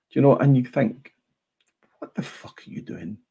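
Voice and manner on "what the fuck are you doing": breathy incredulous whisper; whispered exasperation. Retold or imitated. Fan whispering his disbelief at the trolls. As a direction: hiss it under your breath in disbelief.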